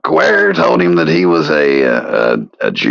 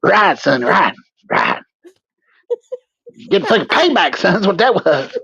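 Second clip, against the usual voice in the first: a put-on hillbilly voice recounting the attack; character voice. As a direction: play the aggrieved victim, voice pitched up high. Voicing the victim in character.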